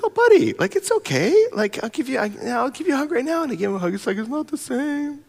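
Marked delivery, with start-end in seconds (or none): 0.0-3.4 soothing. Retold or imitated. Father comforting crying son. 4.2-5.2 put-on sulky child. Son sulks after hug.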